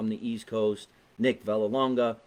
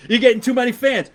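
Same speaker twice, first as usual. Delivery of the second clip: much higher, louder mock jealousy; loud mock outrage; 11 semitones higher, 11 dB louder than usual. Real, not acted. Host jokingly jealous that the guest gets more fans, loud mock outrage. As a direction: protest loudly in jest.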